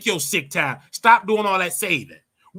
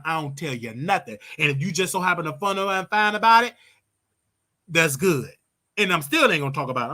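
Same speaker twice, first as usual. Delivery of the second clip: usual speaking voice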